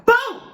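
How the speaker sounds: shouted explosion sound; loud boom. Pretend. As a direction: yell boom suddenly. Mimics channel exploding.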